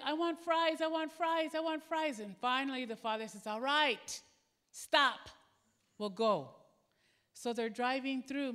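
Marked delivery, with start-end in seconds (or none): 0.0-2.2 put-on high, loud whining kid demanding fries; whiny child. Son in the car whining at his father for fries, high and loud. 3.5-6.5 exasperated father. Retold or imitated. Father giving in to son.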